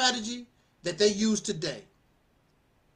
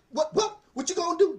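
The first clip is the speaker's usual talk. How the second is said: pretend crazy; 6 semitones higher than usual. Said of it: "Gotta pretend, make 'em think that you're crazy".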